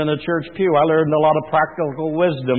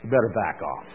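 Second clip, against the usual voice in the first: lower, softer calm warning; a lightly voiced old man backing down a kid; old man's warning; 6 semitones lower, 12 dB quieter than usual. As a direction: warn the punk low and steady like an old coot. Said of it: "The old guy turns and says".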